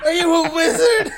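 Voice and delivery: high-pitched incredulous mock shout; hammed-up, high indignant yelling; mock outrage. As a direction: cry out in comic disbelief. Acts killer outraged at the trick.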